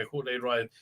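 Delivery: usual speaking voice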